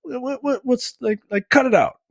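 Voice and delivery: shrill high-pitched mock scolding; high mock rant at couch-testing couple; mock yelling. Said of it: "she's yelling and screaming at them".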